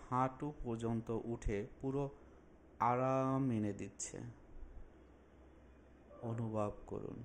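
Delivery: slow, drawn-out phrases with long pauses; unhurried relaxation instructor, lingering on soothing words; guided meditation. Acted. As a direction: guide them calmly through a body scan. Leads a guided body relaxation.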